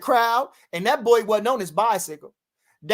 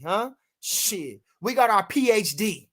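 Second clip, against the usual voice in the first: usual speaking voice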